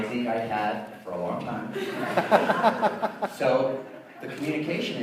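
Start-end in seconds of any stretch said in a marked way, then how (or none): 1.8-3.3 short high laugh burst; real burst of laughter. Real, not acted. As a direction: laugh at the memory. Laughing again.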